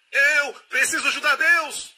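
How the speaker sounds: the worried believer insists, frantic and high; frantic insistence. Acted. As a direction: insist in panic on helping God.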